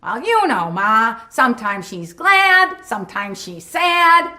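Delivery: high, loud, slow hammy line reading; exaggerated sing-song wooden delivery; mocking bad acting. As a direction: overact it like a terrible actor. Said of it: "So this is how he acted".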